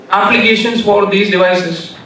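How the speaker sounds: usual speaking voice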